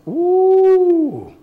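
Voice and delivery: high, drawn-out ooh at the harsh line; mock shocked ooh. Real, not acted. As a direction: react as if stung. Reacting to harsh verse.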